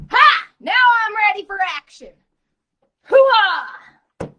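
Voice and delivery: booming war cry, high and loud; loud gung-ho soldier. Acted. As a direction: yell it like a soldier charging. Pumped-up battle cry.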